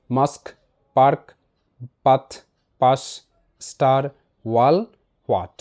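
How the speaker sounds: low, measured word-by-word drill; repeat-after-me word drill. Shown as a demonstration. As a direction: read each word singly, pausing for learners to echo. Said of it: "শব্দগুলো আমি repeat করছি".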